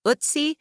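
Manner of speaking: Utsi, opening on an "oo"-like vowel; first pronunciation variant. Shown as a demonstration. Narrator lists ways a nickname is pronounced, first pronunciation variant. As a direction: say "Utsi" with an OOT-see sound. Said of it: "pronounced three different ways".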